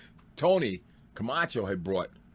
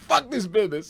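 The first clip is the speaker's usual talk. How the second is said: fed up outburst; 11 semitones higher, 4 dB louder than usual. Retold or imitated. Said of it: "I'm mad as hell".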